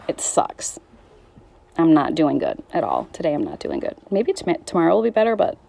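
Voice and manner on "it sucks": a real, barely voiced whisper. Real, not acted. Admitting to viewers it really sucks, whispered. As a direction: let it slip out under the breath. Admits she is not fine.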